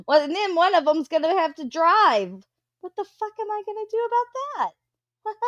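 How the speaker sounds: shrieky high mock dread; mock panic. Real, not acted. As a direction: pitch it up in comic alarm. Mock panic about teen driving.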